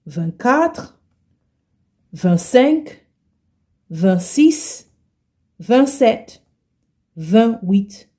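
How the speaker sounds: ordinary talk, nothing marked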